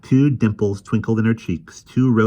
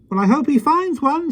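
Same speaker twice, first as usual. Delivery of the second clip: put-on high, lively voice of a small old man; gentle, chatty little fellow; kindly little man; 5 dB louder than usual. Mister Mo speaks.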